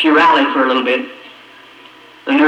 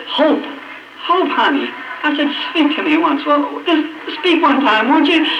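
high-pitched urgent plea; desperate pleading; 12 semitones higher than usual. Retold or imitated. Husband begging his dying wife to speak, desperate plea. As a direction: beg for one word, voice pitched high.

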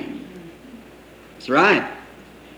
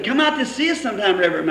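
wife's voice; 6 semitones higher, much faster than usual. Retold or imitated. Wife invites the preacher.

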